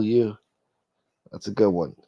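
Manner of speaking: normal talking voice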